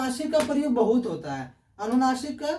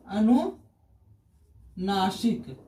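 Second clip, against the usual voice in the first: अनुनासिक very slow and lower, split in two with a long pause; slow dictation; 4 semitones lower, much slower than usual. Shown as a demonstration. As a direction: draw out अनुनासिक part by part for a class. Teacher breaks up the term for students.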